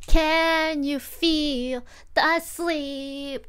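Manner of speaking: slow, drawn-out sleepy question; sleepy singsong. Real, not acted. Asking chat if they feel sleepy, slow drawl. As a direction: stretch it lazily like half asleep.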